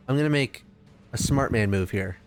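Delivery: ordinary delivery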